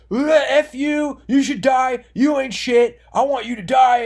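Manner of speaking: hammed-up furious threats; retelling Cyrax screaming threats on stream; imitating angry yelling. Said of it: "An hour of just yelling".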